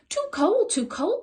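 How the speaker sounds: high excited cry; squeaky alarmed little cry; cried out. A narrator's reading. Creatures cry out at the cold boy, high and excited. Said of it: "they cried".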